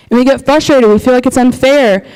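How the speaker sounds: usual speaking voice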